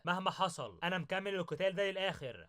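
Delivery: usual speaking voice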